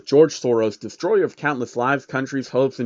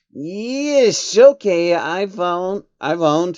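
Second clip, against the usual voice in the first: nervous; 5 semitones higher, 4 dB louder, much slower than usual. Acted. Changeling flinches, scared.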